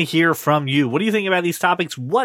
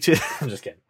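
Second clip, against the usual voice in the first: ordinary delivery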